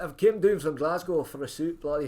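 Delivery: imitating a Glasgow man who drove down for a suit; rough, hammed-up Scottish accent. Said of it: "is that Scottish? Sort of Scottish".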